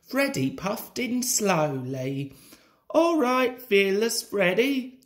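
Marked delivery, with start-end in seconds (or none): 2.9-5.0 high, piping little-engine challenge; tooting call. A narrator's reading. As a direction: toot it out high. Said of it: "tooted Rheneas".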